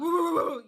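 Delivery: high, loud playful howl; dog howl. Acted. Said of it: "I'm like a fucking dog".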